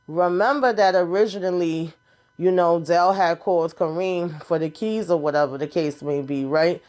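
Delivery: ordinary talk, nothing marked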